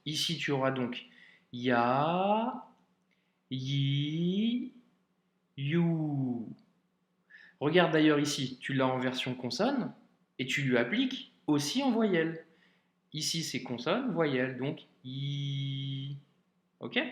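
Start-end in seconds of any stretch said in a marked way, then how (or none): 1.5-6.5 YA, YI, YOU each held long; long vowels. Shown as a demonstration. Teacher showing a pupil long vowels, ya, yi, you drawn out. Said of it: "Pour les voyelles longues". 15.0-16.2 a long, steady held I; long vowel. Shown as a demonstration. Teacher sounding consonant plus vowel, long drawn-out i. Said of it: "Pour les voyelles longues".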